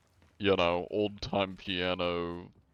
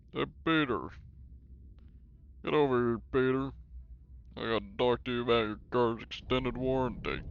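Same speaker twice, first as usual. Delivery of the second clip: rough attempt at a cartoon character, pitched up; Joe Swanson; 3 semitones higher than usual. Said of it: "can't really do a Joe Swanson".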